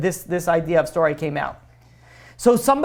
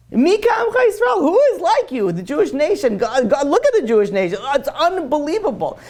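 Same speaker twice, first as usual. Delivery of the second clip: much higher, rapturous praise; awed exclamation; 7 semitones higher than usual. Real, not acted. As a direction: cry out in wonder at the nation. Preacher exclaiming praise of Israel.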